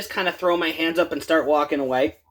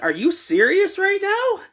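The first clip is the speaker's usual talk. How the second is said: much higher, slow disbelief; incredulous; 11 semitones higher than usual. Retold or imitated. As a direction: stretch it out in disbelief. Incredulous retort to manager.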